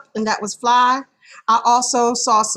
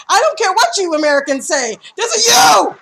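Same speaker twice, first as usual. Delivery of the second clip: mock outrage; 7 semitones higher, 3 dB louder, faster than usual. Mock outrage over spelling.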